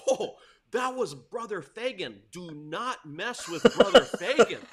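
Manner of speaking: loud, dramatic warning to friends; emphatic retold warning. Student warning new friends at lunch, emphatic warning. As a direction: warn them hard, half-joking and loud.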